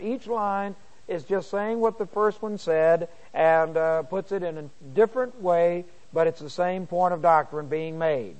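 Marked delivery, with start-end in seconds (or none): none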